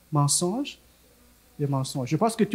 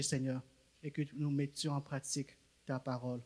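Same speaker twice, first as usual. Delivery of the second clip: quiet earnest prayer, Seigneur between phrases; a gentle prayer of blessing; soft spoken prayer; 9 dB quieter than usual. Acted. Leader praying for Bola before she preaches, quiet earnest prayer.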